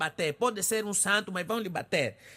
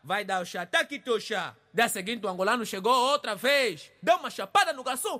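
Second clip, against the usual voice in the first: ordinary talk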